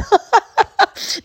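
high burst of laughter. Real, not acted. Mom amused by her remark about losers, high laugh.

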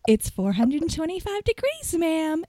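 high, sugary phone voice; syrupy sweet. Retold or imitated. Manager giving oven temperature to a rude caller, syrupy sweet. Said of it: "Did I just get that syrupy sweet?".

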